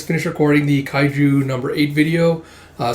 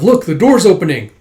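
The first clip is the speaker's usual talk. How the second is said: raised, loud exclamation; excited alert; 5 semitones higher, 8 dB louder than usual. Acted. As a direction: call out a sudden sighting. Onlooker alerts others to door.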